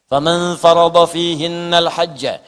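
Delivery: slow, chanted Arabic verse; Quran recitation. Acted. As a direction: intone it slowly like a reciter.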